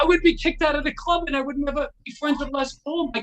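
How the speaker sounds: high, loud disbelief, easing off; retold outraged scolding. Guitarist scolds his thieving passenger, retold outrage. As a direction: scold him in high-pitched dismay.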